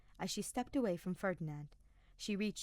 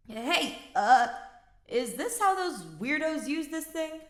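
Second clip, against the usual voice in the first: much higher and louder; ringing shout; 8 semitones higher, 10 dB louder, slower than usual. Acted. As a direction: call out over the arena. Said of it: "Kaspar's voice rang out".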